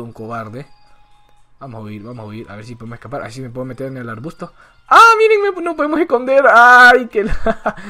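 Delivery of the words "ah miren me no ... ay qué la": high, loud startled burst; excited yelp. Real, not acted. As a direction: yelp at the surprise. Spots something while hiding.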